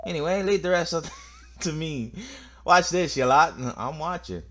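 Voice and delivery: put-on aggressive Scottish accent. Telling the lot to watch, cocky Scottish accent. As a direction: boast to the others in a Scottish accent. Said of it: "this aggressive, like, Scottish accent".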